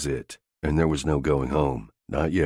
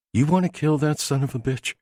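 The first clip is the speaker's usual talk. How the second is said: female character voice; 10 semitones higher than usual. A narrator's reading. Jamie goads Haslam, female voice.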